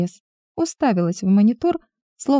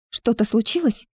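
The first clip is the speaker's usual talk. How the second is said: shaky voice; 2 semitones higher than usual. A narrator's reading. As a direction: let the voice waver a little. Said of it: "Голос Милы дрожал".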